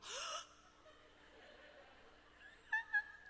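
sharp, very high offended gasp; shocked gasp. Retold or imitated. Insulted wife reacting to husband's gorda with a high gasp.

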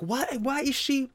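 high, puzzled; as if you can't believe your eyes; baffled. Retold or imitated. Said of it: "I was like".

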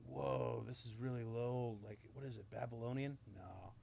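hushed, low, awestruck ham acting; breathy near-whisper, mock dramatic; hammed-up movie character. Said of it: "love how he hams it up".

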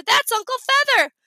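high-pitched yell. A narrator's reading. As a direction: call it out mid-chase. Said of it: "I yelled".

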